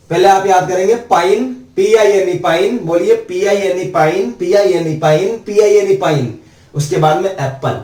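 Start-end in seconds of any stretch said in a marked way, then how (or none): none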